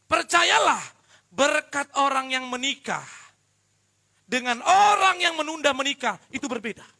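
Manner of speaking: loud, high, emphatic sermon; forceful preaching. Acted. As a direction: hammer the point home to the congregation. (khotbah yang lantang dan berapi-api)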